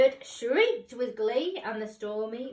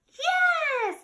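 high eager yes; cried yes; 4 dB louder than usual. Acted. Witch welcoming the dog, high eager cry. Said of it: "cried the witch".

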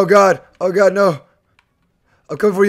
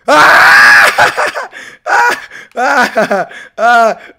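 scream; 2 semitones higher, 4 dB louder, much slower than usual. Real, not acted. Screams when caught.

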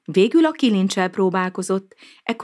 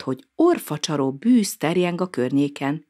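plain reading voice

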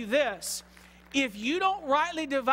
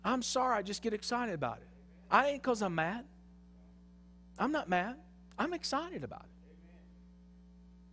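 subdued apology; 7 dB quieter than usual. Real, not acted. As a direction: drop down and explain yourself quietly. Sheepish apology after hollering.

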